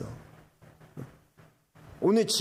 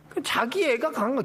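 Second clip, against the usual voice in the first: ordinary talk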